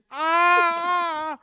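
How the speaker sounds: very loud, high terrified scream; screaming at top of lungs. Retold or imitated. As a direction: let out a full-lunged scream. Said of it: "screaming at the top of his fucking lungs".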